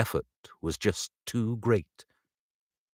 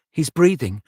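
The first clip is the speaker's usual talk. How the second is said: amazed gasp; 8 semitones higher, 6 dB louder than usual. A narrator's reading. Sees baby breathe.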